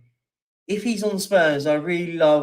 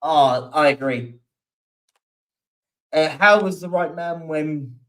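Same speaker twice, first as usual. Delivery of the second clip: ordinary delivery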